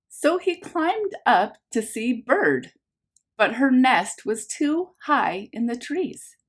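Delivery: lively picture-book narration for a class; reading to children. A narrator's reading. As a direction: read it like story time for little ones. Teacher reads picture book aloud.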